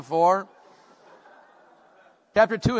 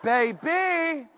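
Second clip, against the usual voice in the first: high, drawn-out, thrilled exclamation; lusty delight; 6 semitones higher, 4 dB louder, much slower than usual. Acted. Preacher acting the reaction to the word ravished, lustily delighted. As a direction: relish it like someone smitten. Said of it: "Aren't you like".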